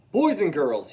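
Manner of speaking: loud call to the class. Acted. Principal addressing the class, loud call for attention.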